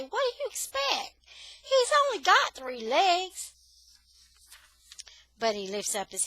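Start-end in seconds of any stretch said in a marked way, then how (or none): 0.0-3.5 defensive retort. Acted. Defending Buddy to cussing passers-by.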